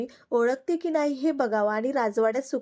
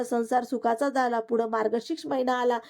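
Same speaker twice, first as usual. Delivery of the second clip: plain reading voice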